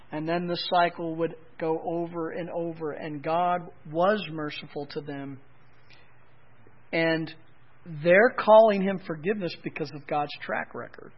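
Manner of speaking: ordinary talk, nothing marked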